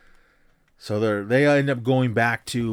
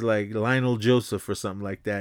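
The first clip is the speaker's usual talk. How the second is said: ordinary talk, nothing marked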